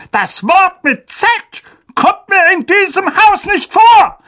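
high, loud shouting uncle; furious shout. Acted. Uncle shouting at Harry about the forbidden word. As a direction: roar the ban on magic. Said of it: "tobte sein Onkel".

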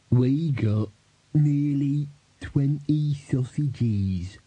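very slow, drawn-out beggar character. A narrator's reading. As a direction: stretch out each word as an odd beggar.

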